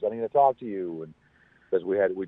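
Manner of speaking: usual speaking voice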